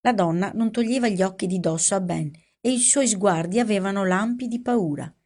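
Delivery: usual speaking voice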